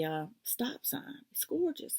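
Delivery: hushed, awed whisper. Real, not acted. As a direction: breathe it out in admiration. Admiring a pretty pattern.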